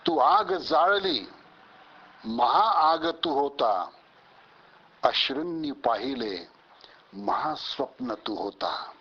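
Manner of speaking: declaimed verse, high rising phrase openings that fall away; slow-stretched words, impassioned poetic address; poem recitation. Acted. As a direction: recite a stirring tribute in measured lines with pauses. Recites own poem of tribute.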